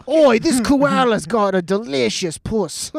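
hammed-up broad Australian, drawn out; Australian accent. Comic riffing about a koala, exaggerated Australian accent. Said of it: "he became Australian".

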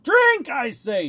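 slow, high, loud command; shouted. A narrator's reading. Monstrous king orders dwarf to drink, shouted. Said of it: "shouted the monster".

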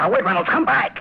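sharp high call after someone running off. Acted. Roberts calling after Reynolds from the window, urgent shout. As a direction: shout him back before he's gone.

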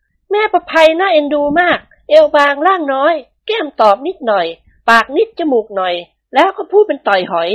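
high, gentle ladylike voice; high-voiced mistress of the house, pleased; lady of the house. A narrator's reading. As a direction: voice the madam sweetly pleased with her hair. Thada describes Prapai.

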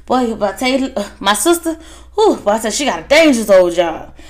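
a fretting brother checking in, voice up high; hammed-up anxious brother rushing his words; worried brother. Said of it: "the brother's like".

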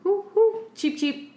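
high hooting owl voice; cartoon bird voice. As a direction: hoot high, then chirp like a bird. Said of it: "when owls hoot and they go like".

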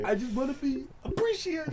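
high, far-off put-on woman's voice; TikTok lady impression. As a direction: imitate the TikTok lady's plea.

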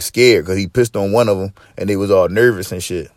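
ordinary talk

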